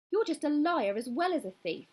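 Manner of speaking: high, loud and nasty accusation; vicious. A narrator's reading. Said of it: "Anne said viciously".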